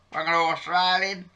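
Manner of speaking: put-on Australian accent. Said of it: "Welcome to Australian Outback T V".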